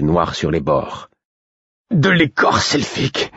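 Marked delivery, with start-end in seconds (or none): 1.9-3.3 strangled voice. A narrator's reading. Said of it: "fis-je d'une voix étranglée".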